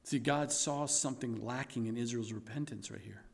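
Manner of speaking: soft, low altar-call voice; soft pastoral preaching. Acted. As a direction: draw them in gently to repent. Softly pastoral exhortation.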